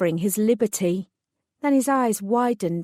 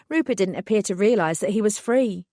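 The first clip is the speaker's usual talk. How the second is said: plain reading voice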